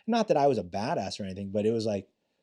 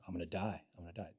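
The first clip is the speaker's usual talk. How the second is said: much softer, small mock-frightened voice; scared murmur; 6 semitones lower, 15 dB quieter, much faster than usual. Trainees recalling their fear at gunpoint, quiet and mock-scared. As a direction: mumble it like a shaken trainee. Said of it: "majority of 'em are like".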